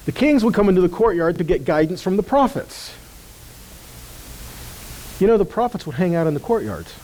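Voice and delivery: usual speaking voice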